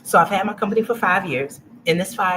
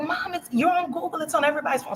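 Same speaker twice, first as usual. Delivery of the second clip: put-on kids voice; 12 semitones higher than usual. Kids voiced telling mom.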